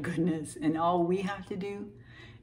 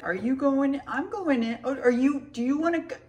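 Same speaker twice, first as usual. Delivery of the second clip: much higher, nervous and eager, stumbling over the offer; awkward high eager voice; 8 semitones higher than usual. Retold or imitated. Imitating herself inviting three strangers to dinner, awkward and high. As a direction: play up how awkward you were, voice jumping high. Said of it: "It wasn't quite as awkward as that".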